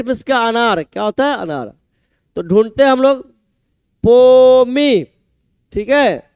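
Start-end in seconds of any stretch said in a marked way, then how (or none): 4.0-5.1 पोमी stretched very slowly; broken into syllables. Shown as a demonstration. Teacher hunting the answer with students, पोमी slowly drawn out. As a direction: draw out both syllables of पोमी. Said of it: "तोड़, तोड़ का ऐसे याद रखेंगे".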